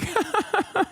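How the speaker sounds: short high-pitched giggle burst; laughter. Real, not acted. Laughs at a chat message.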